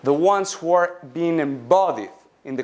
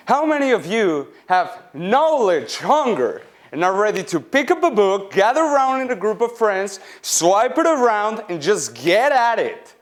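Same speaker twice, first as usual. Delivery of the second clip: louder, higher, building rally to the crowd; enthusiastic rallying call; 5 semitones higher, 3 dB louder than usual. Acted. Student rousing the audience to learn, enthusiastic rallying call. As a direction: fire up the audience with mounting eagerness. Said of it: "only one who's enthusiastic here today".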